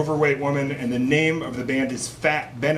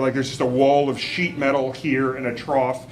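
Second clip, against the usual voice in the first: ordinary talk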